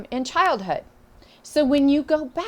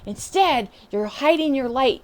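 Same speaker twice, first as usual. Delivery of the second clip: ordinary talk, nothing marked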